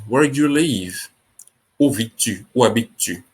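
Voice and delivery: ordinary delivery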